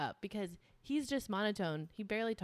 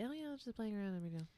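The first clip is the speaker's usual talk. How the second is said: quiet offhand imitation; breezy, dismissive throwaway; casual; 8 dB quieter, much faster than usual. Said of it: "So just casually".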